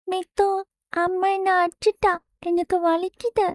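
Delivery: put-on high, soft little girl complaining of being hit; a small girl whimpering to her father; whiny hurt child. Little daughter tells her father mother hit her, whiny child voice. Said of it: "கோபமாக கண்ணீருடன்".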